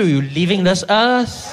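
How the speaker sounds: wife's slow warning shout; menacing wife. Pretend. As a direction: turn it back on him like a threat.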